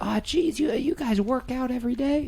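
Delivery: imitating a jittery nerdy guy; mocking Scott as a high squeaky Marty McFly type. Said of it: "sound like Marty McFly".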